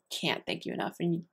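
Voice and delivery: breaking into a near-whisper, close to tears; barely voiced, choked up thanks; real tears held back; tearful whisper. Real, not acted. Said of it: "before I cry".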